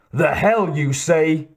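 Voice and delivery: a Western twang, slow and challenging. A narrator's reading. Bigger poacher challenges the Terminator in a Western twang. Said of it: "accent had a good deal of Western twang".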